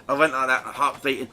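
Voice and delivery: ordinary talk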